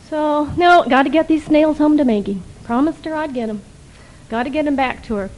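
a mild attempt at an Irish brogue, pressing to get home. Pat refusing his friend Mike in a weak Irish brogue. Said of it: "in a not a very good Irish brogue".

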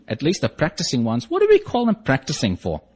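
usual speaking voice

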